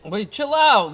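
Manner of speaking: loud. Real, not acted. Startled tuner tells host to stop.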